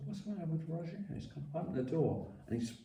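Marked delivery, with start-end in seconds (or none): 0.1-1.0 puzzled thought. Retold or imitated.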